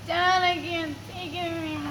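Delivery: ordinary talk, nothing marked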